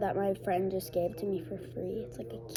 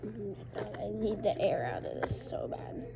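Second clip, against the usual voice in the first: whispered complaint; hushed annoyed whisper; 6 dB quieter than usual. Real, not acted. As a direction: mutter it under the breath.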